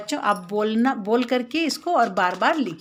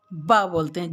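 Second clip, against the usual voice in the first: ordinary delivery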